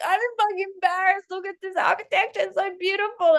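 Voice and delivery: high, thrilled gush over the view; awestruck excitement. Retold or imitated. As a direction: gasp it out like you can't believe the sight. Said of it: "I'm looking around and I'm like".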